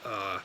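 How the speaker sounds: very low, soft "uh"; low held "uh" without lifting at the end; low third tone. Shown as a demonstration. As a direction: drop "uh" to the bottom of the voice, no rise. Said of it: "focusing much more on just going".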